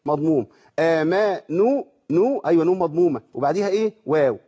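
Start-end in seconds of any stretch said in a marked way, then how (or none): 0.8-1.8 آمنوا with the long واو drawn out slowly; slow tajweed reading of آمنوا, holding the واو; recitation demo. Shown as a demonstration. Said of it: "بيسميه العلماء مد تمكين". (ترتيل آمنوا بمد الواو)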